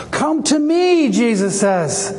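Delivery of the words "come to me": high, ringing invitation; imitated public cry; crying out loudly. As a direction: call it out as Jesus, pitched well up. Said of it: "He said it loudly".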